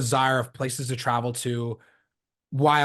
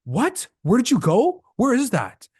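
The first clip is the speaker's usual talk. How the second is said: high, louder mock bewilderment; baffled reaction; 8 semitones higher, 4 dB louder, slower than usual. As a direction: imitate people who can't place it, pitched up. People baffled by unknown destination.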